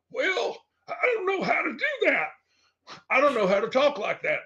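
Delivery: high, put-on befuddled old-timer voice; mock confused boomer. Boomer mocks herself on pronouns, high befuddled voice. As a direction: squeak it out like a lost boomer. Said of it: "I'm like".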